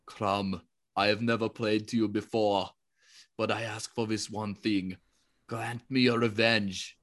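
low, grave barbarian prayer; Conan prayer voice. Retold or imitated. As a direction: growl it like a grim warrior. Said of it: "most backhanded prayer I have ever heard".

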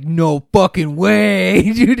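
loud, high, drawn-out disbelief; reliving a thrilled shout; amazed outburst. Retold or imitated. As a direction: stretch it out in excited amazement. Retells own amazed reaction.

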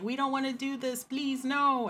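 high, soft little plea; mock pleading. Guest voices own tired head begging for rest, mock pleading.

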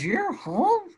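shocked high-pitched disbelief; surprised. Retold or imitated. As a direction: squeak it out in sheer disbelief. Cousin shocked he is home.

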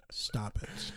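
whispered playful telling-off; mock whisper. Joking with his cohost, he whispers a mock scold at the IPAs. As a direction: hush the beers in a stage whisper.

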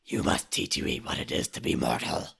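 low, whispered ancient raven. Acted. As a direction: rasp it like a raven spirit. S2 holds the Raven voice.

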